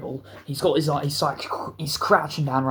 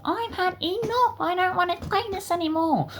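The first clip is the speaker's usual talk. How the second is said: whining sore loser. Retold or imitated. Losing kid quitting the fight club, high whining. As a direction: imitate a sore loser wailing in squeaky falsetto. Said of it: "taking it like an absolute loser".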